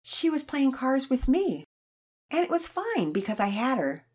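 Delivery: lightly voiced little boy, eager. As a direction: jump in to defend a sister. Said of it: "Pearson jumped in".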